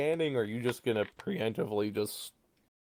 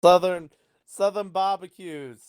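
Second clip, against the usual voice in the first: hammed-up slow Southern drawl; Southern accent; 9 semitones higher, 7 dB louder than usual. Shown as a demonstration. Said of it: "get you into accent".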